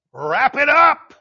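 loud, drawn-out high call; stretched-out call to a long-winded speaker; mock heckling call. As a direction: holler it slowly from the pews. Calling out to long-winded preacher.

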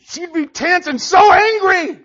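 tense, loud, high imitation; mock anger. Husband imitating his harmless wife's anger, hammed-up tense fury. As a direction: squeeze it out in comic fury.

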